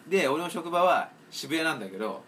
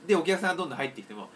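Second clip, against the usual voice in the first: usual speaking voice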